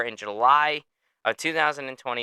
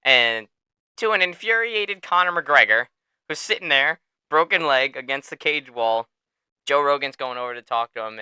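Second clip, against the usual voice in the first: ordinary talk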